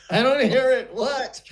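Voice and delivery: high, loud mock bafflement; baffled protest. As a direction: protest in laughing disbelief. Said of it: "And I went".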